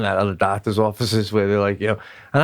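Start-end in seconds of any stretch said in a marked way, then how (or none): none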